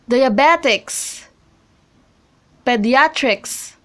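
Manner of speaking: diaBETics, pediATrics, third-from-start or later syllable stressed before -ics; stress before suffix. Shown as a demonstration. Teacher reads examples diabetics, pediatrics, each stressed before the suffix. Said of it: "on a syllable right before the suffix".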